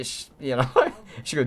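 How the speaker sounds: ordinary delivery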